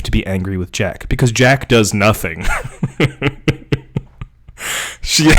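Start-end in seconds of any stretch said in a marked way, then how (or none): 2.5-4.0 breathy burst of giggles; real laughter. Real, not acted. Reader cracking up at Jack doing nothing, laughing. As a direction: crack up helplessly.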